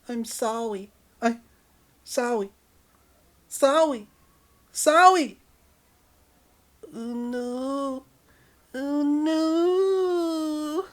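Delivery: put-on very high babyish voice, rising with each sorry; cutesy baby voice. Partner suddenly talks like a baby after vaccine, very high. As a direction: talk like a baby, cutesy and helpless. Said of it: "being all cutesy and acting like a baby".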